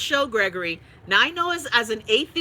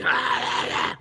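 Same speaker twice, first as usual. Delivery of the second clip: mock the cliché horror demon; slower than usual. Shown as a demonstration. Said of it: "I don't believe the demon voice gotta be".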